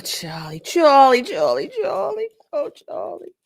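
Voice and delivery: Charlie drawn out high then dropping to a hush; teasing sing-song call to a foolish man; mock sing-song calling. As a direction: call his name like scolding a child.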